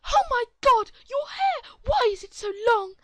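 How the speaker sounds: very high, very loud outraged mother; shrieking mom. Acted. Entitled mother shrieking at the boy's long hair. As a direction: shriek it in mock horror.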